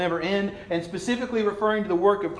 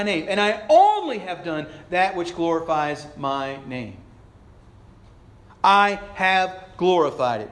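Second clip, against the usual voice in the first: raised, emphatic divine proclamation; God speaking from heaven; 4 semitones higher, 4 dB louder than usual. Acted. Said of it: "God speaks from heaven".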